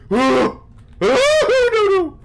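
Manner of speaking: very high, loud shrieks; frightened squeals rising sky-high; panicked screaming. Real, not acted. Fleeing a giant bug.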